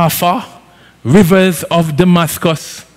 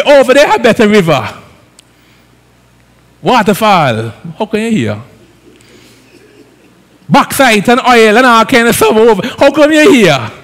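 loud, high, driving sermon climax; full-force preaching; 6 semitones higher, 4 dB louder than usual. Acted. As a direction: preach it hard and rising to the crowd.

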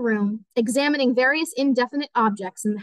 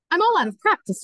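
higher-pitched, anxious excuse; flustered protest; 7 semitones higher than usual. A narrator's reading. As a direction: plead being out of practice, flustered. Embarrassed pianist protesting to host.

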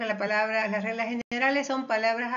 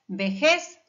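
vejez louder, landing on JEZ; stress on last syllable; 2 semitones lower, 5 dB louder than usual. Shown as a demonstration. Teacher listing aguda examples, vejez stressed on JEZ. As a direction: hit the final JEZ of vejez. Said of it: "también en la última sílaba".

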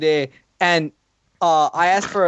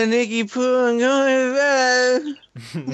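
high mock drunk voice; drunken slurring; 7 semitones higher than usual. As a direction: imitate a barely intelligible drunk heading off. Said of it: "slurs and shit".

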